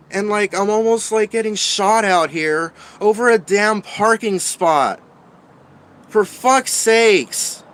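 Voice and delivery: high, loud, shaken venting; upset rant. Real, not acted. Homeless man venting to viewers about being threatened. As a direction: let the fear and anger spill out. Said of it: "that's where I just kinda lost it".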